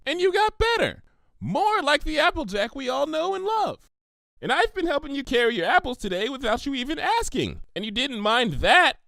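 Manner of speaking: high, quick, reassuring pony; bubbly Pinky voice. Acted. Pinky reminding Applejack how friends helped her, high and warm. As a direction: cheer your friend up warmly.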